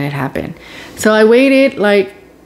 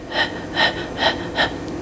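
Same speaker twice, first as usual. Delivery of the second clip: sharp, breathy gasp of sudden pain; 11 dB quieter than usual. Retold or imitated.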